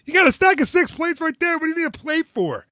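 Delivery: mock-scold him like a grumpy waitress; grumpy server. Server scolding the father-in-law about plates, mock exasperated. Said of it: "and she goes".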